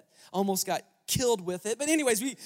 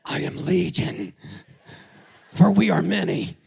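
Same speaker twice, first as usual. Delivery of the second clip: demonic Legion voice; 7 semitones lower, 8 dB louder than usual. Acted. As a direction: thunder it out like a possessed demon. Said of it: "And the voice said".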